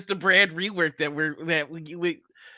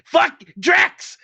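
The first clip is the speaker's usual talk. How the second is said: sudden high yell; 7 semitones higher, 10 dB louder, much slower than usual. Real, not acted. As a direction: shout the name in alarm. Sudden yelled outburst.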